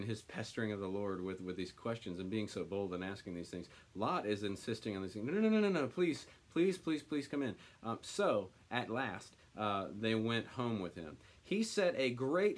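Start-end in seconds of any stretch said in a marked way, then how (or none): 5.3-7.6 high, loud, desperate begging at the start, easing off; Lot's urgent plea; frantic pleading. Acted. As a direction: beg them not to stay outside. Lot pleading with the angels.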